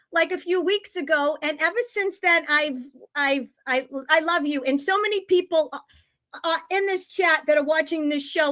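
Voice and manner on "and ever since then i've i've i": ordinary talk, nothing marked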